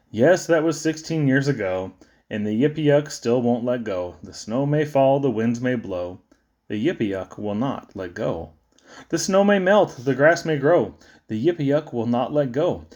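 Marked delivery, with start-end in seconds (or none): none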